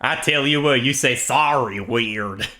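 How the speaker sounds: drawling Texan ribbing; Texan accent. Pretend. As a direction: tease in a hammed-up Texan accent. Texan character mocks Canadian pronunciation.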